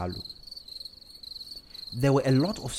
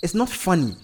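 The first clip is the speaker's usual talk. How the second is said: higher, louder rebuke; snapped; 7 semitones higher, 6 dB louder than usual. A narrator's reading. Wife rebuking husband for laughing, snapped. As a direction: scold him sharply. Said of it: "Maureen snapped".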